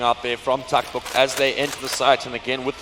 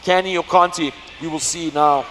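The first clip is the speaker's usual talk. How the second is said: esports caster; 4 semitones higher, 5 dB louder than usual. Acted. Continues hyped play-by-play.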